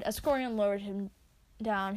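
plain reading voice

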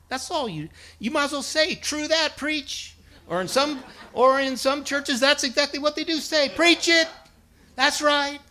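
loud, high-pitched preacher building to a call; fiery preaching. Acted. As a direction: preach it to the church at full force. Preaching at full force.

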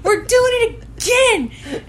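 high, drawn-out fangirl shout; excited. Retold or imitated. Fan reliving the Raimi and Campbell moment, excited. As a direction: re-enact a loud, slow squeal of glee. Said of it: "I was excited".

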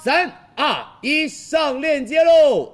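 high, loud, slow countdown; hard sell ad. Real, not acted. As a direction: build hype and launch the sale. Livestream host counts down to link.

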